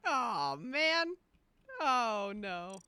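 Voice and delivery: loud groaning aw man, oh no; real frustrated outcry; loud dismay. Real, not acted. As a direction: wail your disappointment loudly. Reacting to own death in game.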